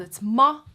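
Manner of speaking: ma held open on the A, loud. Shown as a demonstration. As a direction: sustain ma before the T. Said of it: "mouth in an A".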